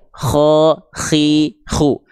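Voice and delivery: "Kho, khi, khu" with breath scraping lightly on each kh; relaxed rasping kh. Shown as a demonstration. Teacher modelling the three vowels, clear rasping kh. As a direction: sound out each syllable with an airy throat rasp. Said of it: "biasa aja".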